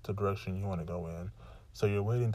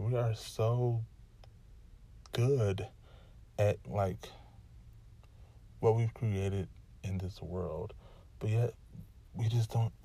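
normal talking voice

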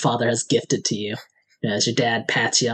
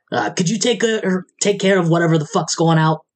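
normal talking voice

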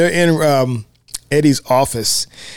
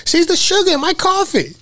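high Roger voice. Retold or imitated. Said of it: "He says".

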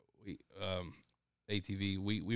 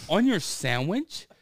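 louder, slow disbelieving ask; incredulous question; 9 semitones higher, 8 dB louder, slower than usual. Retold or imitated. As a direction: draw it out in disbelief.

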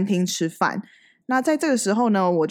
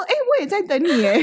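laughing; 12 semitones higher than usual. Retold or imitated. Quoting Amber, laughing.

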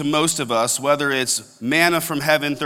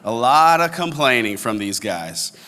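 ordinary talk, nothing marked

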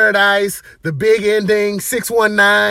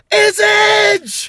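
retold yell. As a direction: shout it out like the buzzer just hit. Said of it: "and I yelled".

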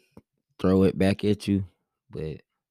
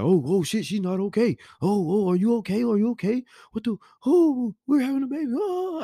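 high, rising, hammed-up panic; mock freaking out; 13 semitones higher than usual. Husband mocking a panicked dad in the delivery room, high squeal. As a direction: climb into a frantic squeal. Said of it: "making shit worse".

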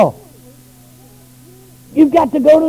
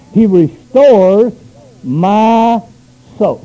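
He restores my soul, each word drawn out slowly; drawn-out reading of He restores my soul; slow stretched scripture; 4 semitones lower, much slower than usual. Shown as a demonstration. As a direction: lean on He and pull the phrase long. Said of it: "say it with me".

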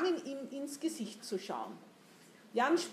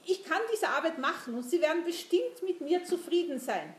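eager, higher-pitched protestation; hasty assurance; 6 semitones higher, 4 dB louder than usual. A narrator's reading. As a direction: assure him hurriedly and eagerly. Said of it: "beteuerte er hastig".

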